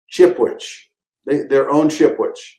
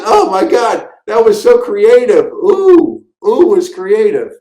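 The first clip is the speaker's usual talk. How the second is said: gushing director; 6 semitones higher, slower than usual. Retold or imitated. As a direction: rave over it like a thrilled director. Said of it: "He goes".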